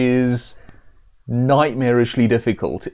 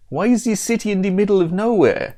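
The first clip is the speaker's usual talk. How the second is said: higher, badly faked Italian accent; 8 semitones higher than usual. Pizza elemental questions the city's location, in high mock Italian accent. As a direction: exclaim in a shaky attempt at Italian. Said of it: "how to do an Italian accent".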